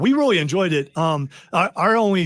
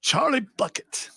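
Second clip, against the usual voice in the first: slow, higher put-on English accent; 6 semitones higher, slower than usual. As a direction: do an English teacher from Willy Wonka. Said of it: "I won't do my English accent anymore".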